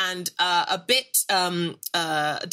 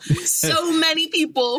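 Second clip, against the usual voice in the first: higher, louder, wide-eyed at the crowd; excited crowd marvel; 10 semitones higher, 4 dB louder than usual. Acted.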